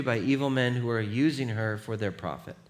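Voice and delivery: ordinary delivery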